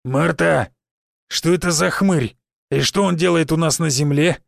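disgruntled questioning in character; reproachful demand. A narrator's reading. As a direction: demand an explanation about the intruder. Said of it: "С претензией в голосе поинтересовался Финн". (с претензией в голосе)

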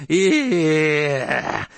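sudden loud yelp of pain; pained cry. A narrator's reading. Baron yelping in pain when pricked. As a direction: cry out as the needle bites.

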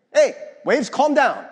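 loud, high shouted order; acted shout to quell the waves; acted command. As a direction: call out firmly over a storm. Jesus commands the storm.

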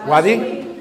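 "¿Wadi?" with a rising questioning pitch. Shown as a demonstration. Teacher checking the class has the word, "¿Wadi?" rising. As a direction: ask "¿Wadi?" back with a lift at the end.

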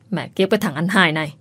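ordinary delivery, nothing marked

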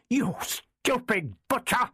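a high, slow, furious cry of contempt; storming insult. A narrator's reading. Doctor storming at the soldier Leicester, high and furious. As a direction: spit out the insult in outrage. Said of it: "he stormed".

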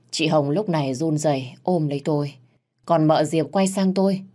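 ordinary delivery, nothing marked

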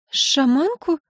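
high, puzzled echo question; surprised. A narrator's reading. Wife hears of the shaman visit, puzzled. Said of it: "удивлённая и непонимающе".

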